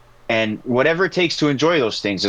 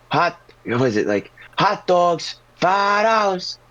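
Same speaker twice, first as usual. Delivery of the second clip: imitating a hot dog vendor, starting calm, then louder and higher; hot dog hawker getting louder and louder; building vendor yell; 8 semitones higher, slower than usual. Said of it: "he just gets louder and louder".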